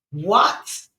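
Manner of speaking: sharp high startled question; a loud high cry of disbelief; shocked what. Acted. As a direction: blurt it out in disbelief. Shocked reaction to dad.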